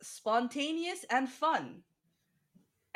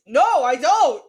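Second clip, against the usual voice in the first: loud refusal; 2 semitones higher, 12 dB louder, slower than usual. Pretend. Reacting in mock horror.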